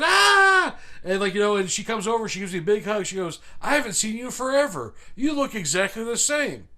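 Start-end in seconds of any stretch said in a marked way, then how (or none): 0.0-0.8 loud, high sustained squeal; over-the-top greeting scream; imitated excited scream. Retold delighted greeting from stranger. 3.6-6.7 high-pitched gushing, imitating a delighted acquaintance; mock-delighted, pitched well up; retold excited greeting.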